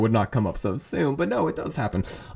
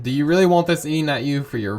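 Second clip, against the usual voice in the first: ordinary delivery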